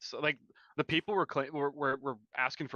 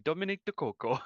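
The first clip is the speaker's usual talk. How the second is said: hammed-up Italian accent name; 5 semitones higher than usual. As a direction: fake Italian accent, slightly higher. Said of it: "It's, like, the best Italian accent".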